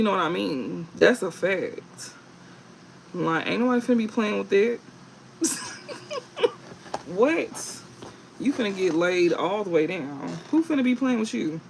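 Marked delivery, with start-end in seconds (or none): 5.4-7.0 high airy giggle. Real, not acted. Laughs at own joke.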